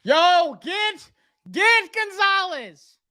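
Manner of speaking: high, pleading cry to the team; high excited plea at the screen; excited high urging. Real, not acted. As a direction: beg them to take him, voice jumping up.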